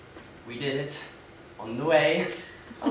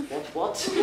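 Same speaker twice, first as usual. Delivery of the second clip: higher, jokey upper-class English "what, what"; upper-class mimicry; 6 semitones higher, 12 dB quieter than usual. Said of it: "That's how the upper class speak".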